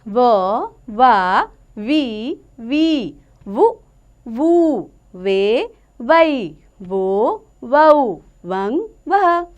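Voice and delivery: slow, drawn-out teacher's chant of each syllable; slow syllable recitation. Shown as a demonstration. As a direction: sound out every syllable slowly for children. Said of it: "अब हम पढ़ते हैं व की मात्रा". (धीमे-धीमे अक्षरों का उच्चारण)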